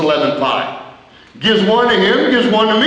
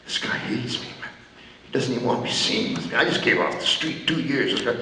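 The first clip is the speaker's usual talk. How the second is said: hushed worried thoughts; muttering fears under the breath; inner thoughts; 7 semitones lower, 10 dB quieter, faster than usual. Retold or imitated. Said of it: "I'm thinking".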